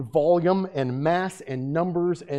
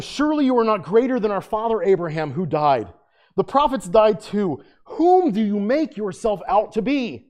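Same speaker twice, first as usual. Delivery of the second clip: accusing challenge; 5 semitones higher, 5 dB louder than usual. Acted. Pharisees argue with Jesus.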